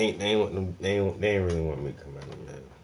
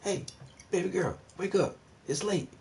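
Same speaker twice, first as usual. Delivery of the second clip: sweet; 9 semitones higher than usual. Shown as a demonstration. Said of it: "just kinda be sweet".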